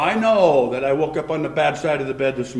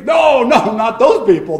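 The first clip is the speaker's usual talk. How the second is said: alarmed protest; 6 semitones higher, 8 dB louder than usual. Acted. Habakkuk protests God's answer.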